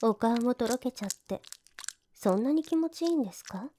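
normal talking voice

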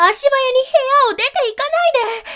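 loud, high plea to stop; pleading call. Acted. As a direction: call out, don't let him leave. Master rushing out of room.

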